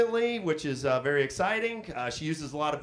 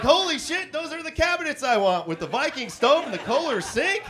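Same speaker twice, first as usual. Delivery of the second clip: much higher, laughing excitement; excited; 10 semitones higher than usual. Retold or imitated. Man pausing porn to admire cabinets, high excited gush.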